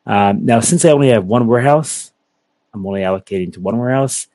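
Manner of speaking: ordinary talk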